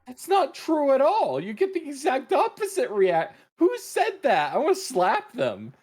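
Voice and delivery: high, loud, outraged protest; indignant disbelief. Real, not acted. Friend disputing the yell-fire advice, indignant disbelief. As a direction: burst out in appalled disbelief.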